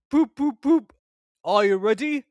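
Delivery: high, loud poop poop; whistle toot. A narrator's reading. Gordon whistles.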